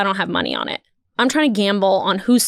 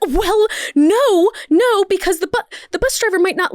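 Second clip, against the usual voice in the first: indignant woman; 9 semitones higher than usual. Retold or imitated. Woman arguing over bus seats.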